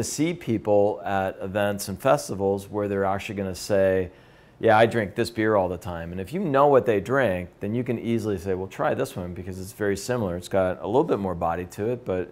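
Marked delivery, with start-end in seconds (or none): none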